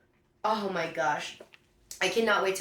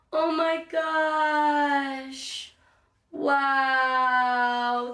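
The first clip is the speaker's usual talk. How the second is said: long, stretched-out words in a higher voice; amazed, touched; 4 semitones higher, much slower than usual. Real, not acted. Unboxer moved by a handwritten note, drawn-out amazement. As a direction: hold each word in real wonder.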